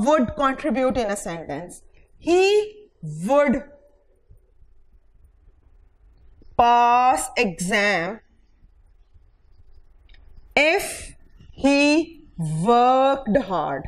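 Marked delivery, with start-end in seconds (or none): 2.2-13.8 each word spaced out slowly for students; measured classroom dictation. Shown as a demonstration. Teacher dictates example sentence.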